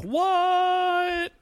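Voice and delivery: a sudden squeaky high "What?"; a squeak of pure disbelief; shocked what. Retold or imitated. Hosts reacting to Kaku's reveal, squeaking in shock.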